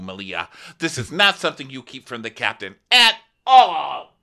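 loud, high scolding; hammed-up angry boss yelling; mock furious captain. Captain scolding the stewardess, hammed-up loud rant. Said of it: "she goes".

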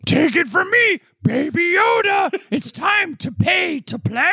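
very high, squeaky creature voice; high cartoon Baby Yoda pitching pay-to-play. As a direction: squeak it out like a tiny alien baby. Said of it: "Baby Yoda voice announced earlier".

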